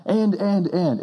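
excited storyteller. Shown as a demonstration. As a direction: build the excitement from one event to the next. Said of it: "And it's exciting".

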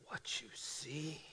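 put-on slow, foreign-accented whisper; dramatic whisper. Piano teacher asking student in mock speech, slow whisper. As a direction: draw out the question in a hush. Hushed build-up to final punchline.

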